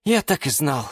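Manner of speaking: restrained, let-down voice; resigned sigh. A narrator's reading. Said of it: "вздохнул Юра".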